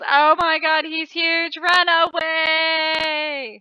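loud cry ending in a long drawn-out wail; mock panic. Real, not acted. Player fleeing a huge worm, loud drawn-out panicked cry. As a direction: play it as fleeing a monster.

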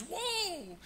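high startled whoa to the horse; confused excited whoa. Acted. Preacher panicking at the cliff edge, high excited whoa. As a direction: yank the reins with a high whoa. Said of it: "he gets confused and excited".

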